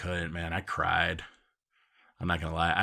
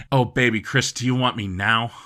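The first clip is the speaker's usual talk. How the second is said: a louder, higher, hammed-up come-on; mock seductive mom; 4 semitones higher, 7 dB louder than usual.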